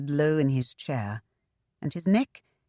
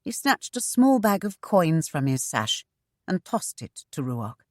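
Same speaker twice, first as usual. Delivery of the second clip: ordinary delivery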